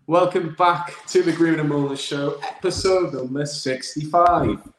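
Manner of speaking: upbeat, loud announcer welcome; show host intro. Acted.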